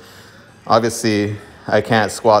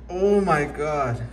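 high, slow, drawn-out amazement; real amazement; 6 semitones higher, 5 dB quieter, much slower than usual. Real, not acted. Card opener sees a rare pull, amazed. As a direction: stretch it out in awe, pitched up.

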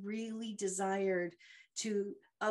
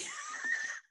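sudden high-pitched burst of a laugh; squealing self-mocking laugh. Real, not acted. Laughing at her nervous self.

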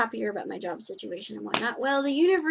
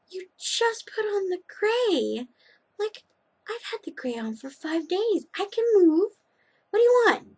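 mock professor; 9 semitones higher, 3 dB louder than usual. The professor answering her color question, mock-exasperated and high. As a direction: send up the professor's impatience. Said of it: "And the professor's like".

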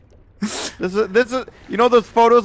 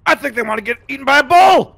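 fast, higher hype shout; excited; 5 semitones higher, 4 dB louder, faster than usual. Real, not acted. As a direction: charge in with a battle cry. Hype call while charging.

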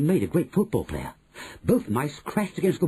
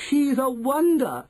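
high, drawn-out crowd voice; admiring cry; 13 semitones higher, 4 dB louder, slower than usual. A narrator's reading. Witch audience praising their leader, admiring cry. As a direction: gush it at high pitch. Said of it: "cried the audience".

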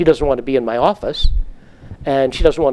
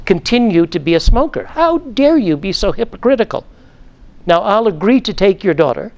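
stern scolding; 3 semitones higher than usual. Retold or imitated. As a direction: scold a hypocrite father with cold authority. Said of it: "I say to him sternly".

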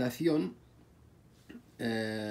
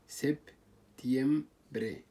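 سبتيمبري very slow, syllable by syllable; split into syllables; much slower than usual. Shown as a demonstration. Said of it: "نبغى نسوي لها تقسيم". (سبتيمبري مقسمة ببطء شديد)

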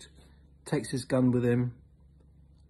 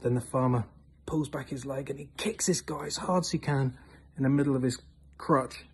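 ordinary talk

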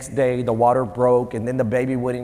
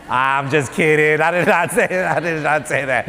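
laughing while backtracking on a joke; real amused disclaimer. Real, not acted. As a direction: chuckle through the retraction. Laughing off his own joke.

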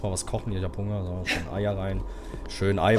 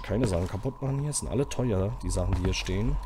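ordinary talk

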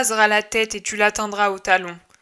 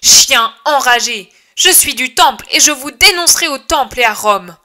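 furious shout; 3 semitones higher, 7 dB louder than usual. Acted. Judas shouting threats at the stone-throwing crowd, loud and furious. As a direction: threaten them with the temple and Rome. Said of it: "Il hurle".

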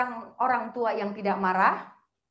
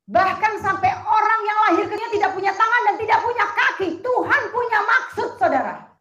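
preaching; 9 semitones higher, 10 dB louder than usual. Acted. Preaching at full force.

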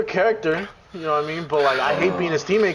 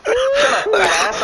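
louder, high mock outburst; high-pitched retold insult; 5 dB louder, slower than usual. Gamer retelling a kid's insult, high and worked up. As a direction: imitate the insult, high and loud.

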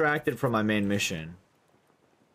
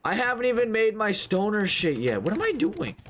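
flustered; 11 semitones higher than usual. Real, not acted. Flustered at own progress.

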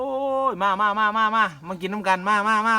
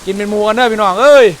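calling out; 2 semitones higher, 10 dB louder than usual. Real, not acted. Calling viewers over rain noise.

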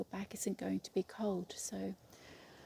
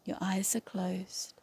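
calm yoga nidra guide with long, restful pauses; guided meditation. Real, not acted. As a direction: lead them into stillness, one unhurried phrase at a time. Leads a yoga nidra relaxation.

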